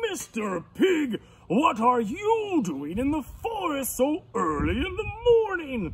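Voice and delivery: swooping, hearty lion questioning a visitor; surprised lion. Acted. Lion greets an early visitor, big and surprised.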